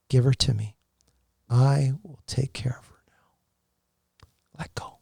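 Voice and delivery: slow, breathy whisper of a divine voice; a man whispering the words he heard from God while bathing his dying wife. Retold or imitated. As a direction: whisper it slowly, like a presence in the room. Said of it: "I heard that, like, three times".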